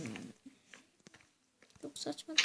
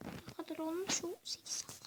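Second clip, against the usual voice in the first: unvoiced hushed whisper; 9 dB quieter than usual. Real, not acted. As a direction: whisper the question softly. Whispered aside.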